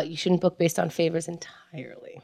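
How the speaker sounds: unvoiced whispered aside. Real, not acted. Host whispering booking advice to co-host, hushed. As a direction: whisper it like a secret.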